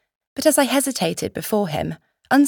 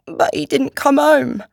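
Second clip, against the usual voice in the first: put-on high-pitched sick boy, upset; thick, tearful voice; 7 semitones higher, 4 dB louder than usual. A narrator's reading. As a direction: plead thickly like a poorly, worried lad. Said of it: "his voice thick".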